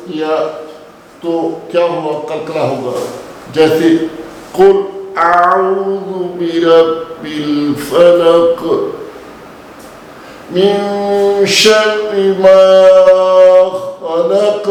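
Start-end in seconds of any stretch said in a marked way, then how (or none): 4.6-14.6 slow, drawn-out louder recitation; Quranic recitation. Shown as a demonstration. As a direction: recite it slowly, full and heavy. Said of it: "काफ को पुर पढ़ेंगे". (धीमी, ज़ोरदार क़िराअत)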